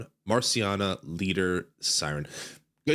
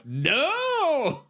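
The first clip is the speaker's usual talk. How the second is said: surprised shout. Real, not acted. Sudden cry at game screen.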